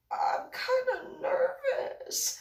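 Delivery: very high, slow hesitant squeak; mock nervous. Photographer balking at the wire shoot, nervous. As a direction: imitate a worried colleague, stretching it out high. Photographer's worried reply, acted.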